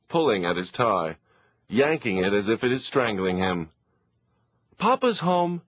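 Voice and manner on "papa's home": put-on boy announcing. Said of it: "Leo announces".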